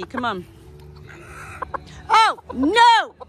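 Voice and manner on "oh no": high, loud cry of dismay; alarmed cry. Real, not acted. Owner seeing bird in trouble, alarmed cry.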